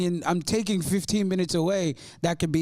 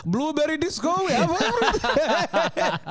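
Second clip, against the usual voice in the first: put-on higher, slow Arabic-accented clerk through laughter; 8 semitones higher than usual. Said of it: "an Arabic strict accent".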